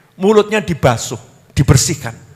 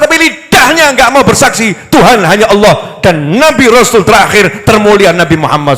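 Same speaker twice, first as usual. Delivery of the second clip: high, loud, rousing repetition; fiery preaching; 9 semitones higher, 5 dB louder than usual. Acted. Preacher rousing the congregation, full-force preaching. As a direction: hammer home that God is there for them.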